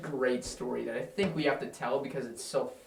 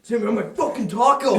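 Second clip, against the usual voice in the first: mock accusation; 6 semitones higher, 8 dB louder, faster than usual. Imitating friend's accusation.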